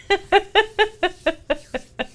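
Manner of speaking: a real laugh bursting out high; loud high laugh that trails down; big laugh. Real, not acted. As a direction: let the laugh break out, then fall away. Cracks up at own bit.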